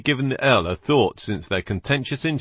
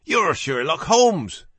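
surprised; 8 semitones higher, 3 dB louder, slower than usual. Acted. Captive recognizes the famous detective.

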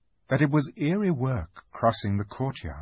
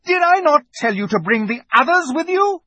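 high, loud scolding queen; piercingly high royal rebuke; terrible witch; 6 dB louder than usual. A narrator's reading. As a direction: demand it in imperious anger. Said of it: "said the witch in a terrible voice".

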